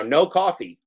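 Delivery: ordinary talk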